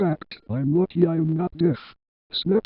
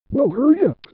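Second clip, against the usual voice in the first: high-pitched irritated urging; angry; 9 semitones higher, 4 dB louder than usual. A narrator's reading. Rudy hurrying Penny along in an angry voice. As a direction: narrate an impatient character, pitch jumping up. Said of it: "said Rudy in an angry voice".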